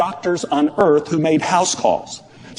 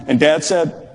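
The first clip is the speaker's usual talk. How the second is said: ordinary talk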